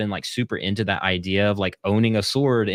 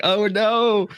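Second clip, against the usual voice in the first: much higher, louder oh no; dismayed; 13 semitones higher, 5 dB louder than usual. Retold or imitated. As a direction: yelp it in sudden dismay. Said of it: "So I was like".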